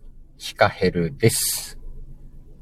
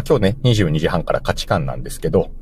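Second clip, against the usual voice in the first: normal talking voice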